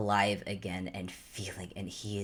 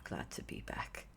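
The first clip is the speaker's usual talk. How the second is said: much softer and lower, barely voiced; whispered relief; 8 semitones lower, 11 dB quieter than usual. [name] answering his friend's welcome in an exhausted, grateful whisper. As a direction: breathe it out, spent from crying.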